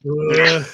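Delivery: long wordless "ugh"; re-enacted morning-after groaning; imitated hungover groan. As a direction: groan like a bad hangover. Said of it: "we were just making noises".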